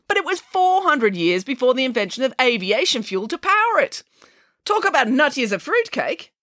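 high, animated pig holding forth; breezy, exuberant conviction; Nanny Piggins, enthusiastic. Acted. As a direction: argue with swooping high pitch.

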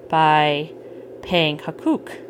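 ordinary delivery

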